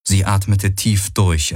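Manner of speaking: ordinary delivery, nothing marked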